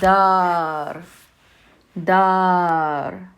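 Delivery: Dar with a long, open ah; long vowel demo. Shown as a demonstration. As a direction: stretch the ah in Dar. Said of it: "whether that word has a long vowel".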